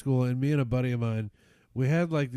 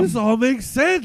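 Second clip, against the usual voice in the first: higher, louder mock epiphany; mock realization; 10 semitones higher, 8 dB louder than usual. Son joking about inheriting dad's habits, mock epiphany. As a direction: play the dawning, hammed-up. Teen's sarcastic inner thought.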